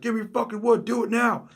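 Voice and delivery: mock robber yelling. Bandit pressing his victim for wood, yelling. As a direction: shout the demand in a strained high pitch.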